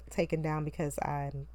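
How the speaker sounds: ordinary delivery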